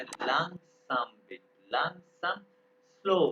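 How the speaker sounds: लैंगसम बिट said very slowly. Shown as a demonstration. Teacher models asking him to slow down, very slowly. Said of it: "मैं तेज पढ़ा रहा हूँ".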